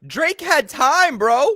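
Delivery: very high, loud disbelief; outraged yell. Real, not acted. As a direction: yell in frustration at the quarterback. Reacting to the interception.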